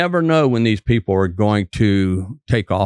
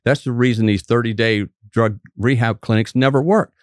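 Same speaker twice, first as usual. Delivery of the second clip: usual speaking voice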